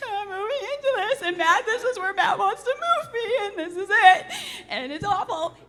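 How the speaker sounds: high, fast, half-laughing mock sob; mock crying. Wife reliving crying in the dirty house, mock sobbing. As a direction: wail it as a hammed-up cry. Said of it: "I just start crying".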